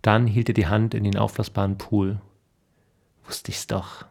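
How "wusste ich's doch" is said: leise geflüstert, bestätigt; flüster es triumphierend für dich; Flüstern zu sich selbst. Acted. Mann allein im Garten. (zu sich selbst geflüstert)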